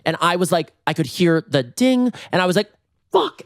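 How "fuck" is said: high-pitched yelp of a curse; panicked curse. Retold or imitated. Storyteller hearing the elevator ding, panicked high curse. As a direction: squeak it out in mock panic.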